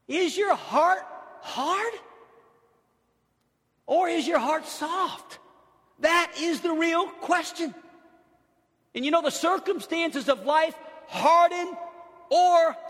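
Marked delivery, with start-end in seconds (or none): 0.1-7.8 slow, high and deliberate; slow emphatic question. Real, not acted. Pastor challenges the congregation, slow and high. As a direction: lean on every word.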